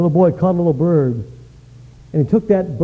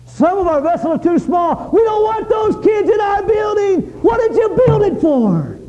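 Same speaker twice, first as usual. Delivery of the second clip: fiery preaching; 13 semitones higher than usual. Acted. Preacher rebuking the church over its fancy building, shouting. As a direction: thunder it at the congregation.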